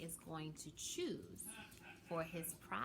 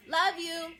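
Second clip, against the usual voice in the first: higher and louder, tossed over the shoulder; called out love you; 10 semitones higher, 10 dB louder than usual. A narrator's reading. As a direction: call it back while running inside. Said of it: "yelled L J as he rushed inside".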